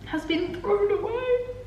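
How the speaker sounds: high, soft upset whine. Real, not acted. Vlogger reports her German school stuff is gone, upset. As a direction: lament it softly, pitched high. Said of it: "So I'm really upset".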